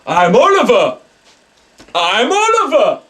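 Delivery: high, loud, bouncy cry; sprightly midget spirit. Retold or imitated. Psychic voicing the ghost Oliver, a sprightly excited shout. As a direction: shout it like a happy little ghost. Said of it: "He's going".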